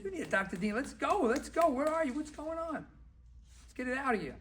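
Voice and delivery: high-pitched urging; rousing pep talk. Acted. Doctor urging a floundering client to go, rousing pep talk.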